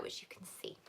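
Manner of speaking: whispered afterthought; whispered aside. Pretend. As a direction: drop to a whisper. Pointing out certificate at bottom.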